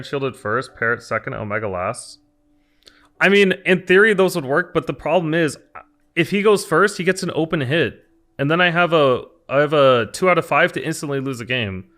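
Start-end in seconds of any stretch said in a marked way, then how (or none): 3.2-11.9 loud, high, pushing his point; raised voice defending the play; heated. Real, not acted. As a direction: argue it hard against chat.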